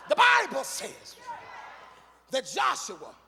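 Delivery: loud, sung whooping sermon; full force preaching. Acted. Preacher telling Jericho to the church, full force. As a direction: shout the story over the organ.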